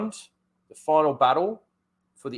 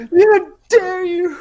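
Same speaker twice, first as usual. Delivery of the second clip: high, loud, slow cry; mock outrage; 8 dB louder, slower than usual. The friend scolding the tree, high hammed-up shriek.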